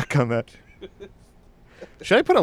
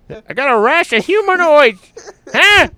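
put-on high, loud hard-of-hearing old man; 13 semitones higher, 4 dB louder than usual. Patient confused by a question about his rash, old man voice.

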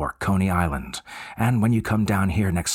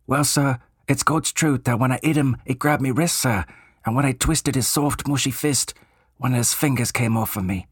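higher Cockney voice of an eager bellboy; 6 semitones higher than usual. Acted. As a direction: play the chatty Cockney servant, keen to help. Cockney bellboy confesses horror.

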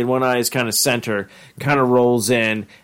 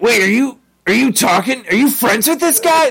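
other Ricks voice; 10 semitones higher than usual. Retold or imitated. Other Ricks confronting Jerry about his friend, high and incredulous. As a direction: demand it in disbelief. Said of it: "they're like".

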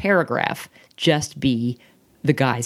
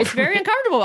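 mock echo; 13 semitones higher, much faster than usual. Mocking partner's phrase, high.

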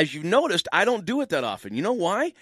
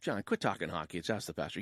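scolding mom; 7 semitones lower, 8 dB quieter, faster than usual. Acted. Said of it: "I'm sorry, Mom".

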